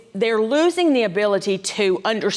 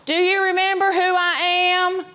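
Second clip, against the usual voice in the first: yelling at someone; 10 semitones higher than usual. Acted. Caregiver bending down to an older adult's face, yelling. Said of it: "then yell at them".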